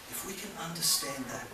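usual speaking voice

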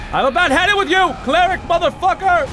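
high, fast fed-up yell; exasperated outburst. Real, not acted. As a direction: snap at the boss in high-pitched frustration. Fed up, repeats it louder.

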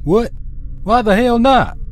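high, sharp demand; angry. Acted. Clyde angrily challenging the doctor. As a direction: snap the question back. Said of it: "Clyde asked angrily".

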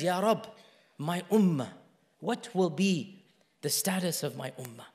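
soft, low, halting supplication; hushed retold prayer, sinking away; tearful plea, understated. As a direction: plead quietly, with pauses between phrases. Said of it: "then he began to cry".

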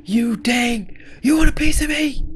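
hammed-up, high-pitched fury; fighting-mad character. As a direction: squeal a challenge to fight. Acted character challenges to a fight.

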